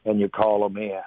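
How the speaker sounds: ordinary talk, nothing marked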